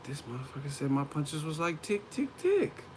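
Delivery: soft, rising to high squeaky ticks; brooding mock indignation. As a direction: grumble quietly, climbing in pitch. Acts out brooding at the movies.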